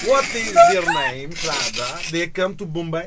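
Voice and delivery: put-on high, slow Russian accent. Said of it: "say that in Russian".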